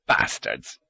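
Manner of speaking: low, dismissive curse. A narrator's reading. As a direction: drop it low as a verdict. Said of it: "He offered the dismissive judgment".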